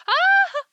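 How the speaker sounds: sudden high loud cry of pain; a real startled yelp as the dog bites; sharp yelp. Real, not acted.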